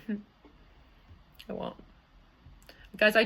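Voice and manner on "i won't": whispered aside. Real, not acted. Hushed aside continues.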